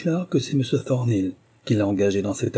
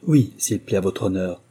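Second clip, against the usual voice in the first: usual speaking voice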